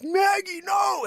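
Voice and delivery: high loud shout of the dog's name; calling the dog. Retold or imitated. Owner shouting at the dog running out. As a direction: yell after the runaway dog. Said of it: "then I'm like".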